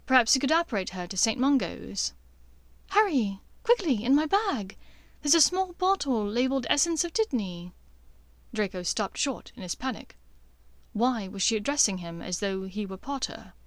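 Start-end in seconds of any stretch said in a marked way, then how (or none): 2.9-7.7 higher, urgent and hurried; panicked Hermione. Acted. Hermione urging Harry to fetch the dittany, higher and frantic.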